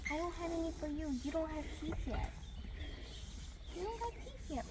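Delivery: high sing-song to the foal. Real, not acted. Granddaughter talks to foal.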